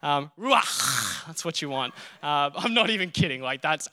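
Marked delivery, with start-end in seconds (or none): 0.4-1.3 ruach with a throaty ch at the end, roo-AHKH; Hebrew word ruach. Shown as a demonstration. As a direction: say ruach slowly, the final ch scraped in the throat. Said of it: "that word I taught you".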